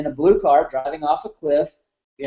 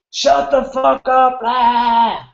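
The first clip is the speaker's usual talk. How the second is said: mock drunken rage, higher and slow; drunk dad's yell; 7 semitones higher, much slower than usual. Drunk father yelling at crying son, imitated raging yell. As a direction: snarl it like an angry drunk father. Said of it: "had this major, like, temper".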